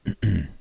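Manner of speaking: quick throat clear. Real, not acted. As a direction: clear the throat mid-sentence. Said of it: "a little tickle in my throat".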